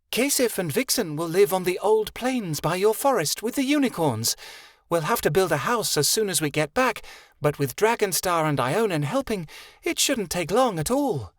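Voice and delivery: high, proud fairy voice laying out orders; a regal little fairy settling matters; haughty fairy Vixen. A narrator's reading. Vixen plans the future.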